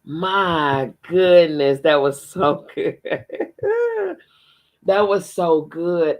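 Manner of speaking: slow, loud, laughing delight; overjoyed. Real, not acted. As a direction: savor how good it was. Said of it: "moved reaction to ending".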